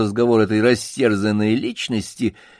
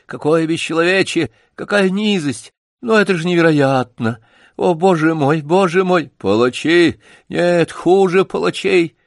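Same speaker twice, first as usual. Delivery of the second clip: высоко и громко, в ужасе; собаки в ужасе выкрикивают; отчаянные крики; 7 semitones higher, 5 dB louder than usual. Acted. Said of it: "Отчаянные крики". (громкий возмущённый крик)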